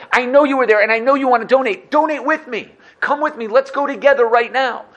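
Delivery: high, loud rallying call to give; rally a friend to donate now; eager urging. Acted. Voicing people urging each other.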